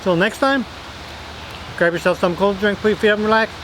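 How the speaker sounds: excited sign-off. Real, not acted. Upbeat sign-off to viewers.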